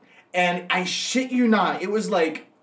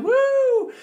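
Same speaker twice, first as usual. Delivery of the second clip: cheer. Retold or imitated. Party guests cheering.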